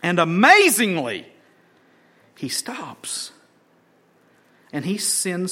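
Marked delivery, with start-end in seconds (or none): 2.3-3.3 soft whispered reveal; preacher whispering he stops. Real, not acted. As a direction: drop to a whisper for awe. Preacher's dramatic pause.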